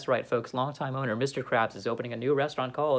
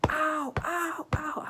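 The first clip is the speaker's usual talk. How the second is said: cries of pain; 10 semitones higher, 3 dB quieter, much slower than usual. Acted. Plankton hurt.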